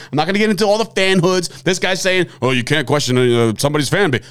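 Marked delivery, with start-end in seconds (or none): 2.4-4.2 low monotone mock quote. Host mockingly quoting his co-host, monotone. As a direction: quote him back in a lowered drone. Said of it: "I gave you monotone".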